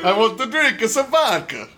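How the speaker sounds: put-on Russian-vampire accent. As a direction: do a cartoon Russian, half vampire. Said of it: "almost like a vampire".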